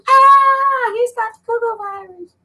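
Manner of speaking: panicked bystander. Retold or imitated. Voices a frightened bystander.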